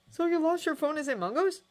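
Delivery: ordinary delivery, nothing marked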